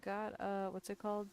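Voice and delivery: ordinary talk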